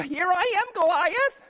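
high-pitched eager call; young challenger answering. Acted. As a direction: pipe up high to the giant. David answers the giant.

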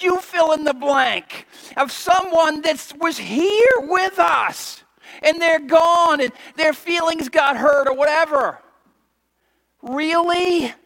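high, loud disbelief; preacher's indignant disbelief at the congregation; incredulous. Acted. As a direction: ask as if you can't believe your ears. Preaching at full force.

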